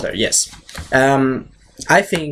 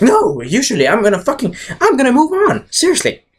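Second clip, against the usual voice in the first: higher-pitched, insistent denial; lightly acted high-voiced Joker insisting; acted Joker protest; 11 semitones higher than usual. Acting Joker's protest to Batman.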